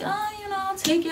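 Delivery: high, soft, carefree imitation; breezy mock reply. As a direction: toss it off lightly in a high soft voice. Retold compliant reply to nurses.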